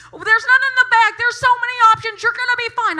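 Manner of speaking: high, loud, fast panicky chatter; mock a clerk babbling comfort; hammed-up squeaky kid voice; frantic teen clerk. Clerk quoted, panicky reassurance.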